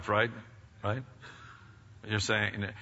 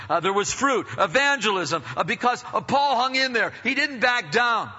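raised, higher preaching voice; emphatic preaching; 8 semitones higher, 4 dB louder than usual. Real, not acted. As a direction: drive the point home from the pulpit. Preacher builds to emphatic point.

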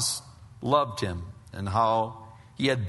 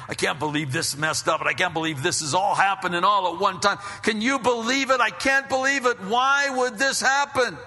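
higher and louder, rising into a panicked rant; frantic disbelief; 9 semitones higher, 6 dB louder than usual. Retold or imitated. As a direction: relive the panic of being attacked, faster and faster. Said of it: "I remember as a young believer".

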